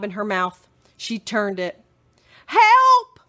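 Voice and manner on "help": loud high cry for help; calling for help. Acted. Missus Watson calling out for help. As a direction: yell out for help, louder. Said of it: "Missus Watson called again".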